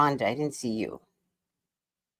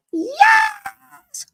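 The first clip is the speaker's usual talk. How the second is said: high, loud cry of joy; excited shout; 5 dB louder than usual. Real, not acted. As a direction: shout it out in triumph. Delight, mic now working.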